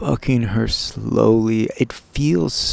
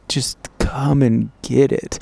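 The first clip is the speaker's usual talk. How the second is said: ordinary talk, nothing marked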